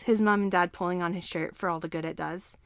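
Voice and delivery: usual speaking voice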